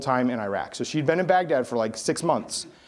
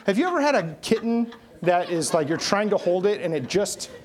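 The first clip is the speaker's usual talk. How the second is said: normal talking voice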